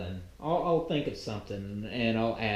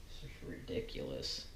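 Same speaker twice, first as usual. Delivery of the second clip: whispered; 10 semitones lower, 15 dB quieter than usual. Real, not acted. Muttering frustration to himself.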